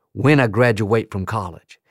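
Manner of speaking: ordinary delivery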